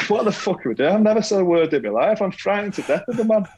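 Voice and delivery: laughing inner voice. Retold or imitated. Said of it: "I think".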